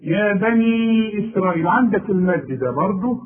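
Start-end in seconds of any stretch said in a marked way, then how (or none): 0.0-1.7 يا بني إسرائيل recited in Quranic style; recitation demo. Shown as a demonstration. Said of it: "أهي الياء دي اللي في القرآن". (تلاوة يا بني إسرائيل بمد واضح)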